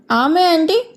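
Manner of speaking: mock-innocent question, pitch lifted; teasing. A narrator's reading. Father pretends not to understand his son, teasing. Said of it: "కావాలనే ఆట పట్టించాడు".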